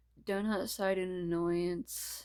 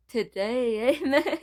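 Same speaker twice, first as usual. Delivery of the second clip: louder Scottish-accented today, eh; 5 semitones higher, 10 dB louder, slower than usual. Shown as a demonstration. Reader retries the line in a Scottish accent. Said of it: "When the accent slips".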